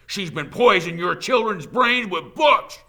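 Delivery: high, shouted accusation; loud declaration. A narrator's reading. Mob leader accusing the teacher, shouting. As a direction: whip up the mob at full volume. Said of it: "Trout declared".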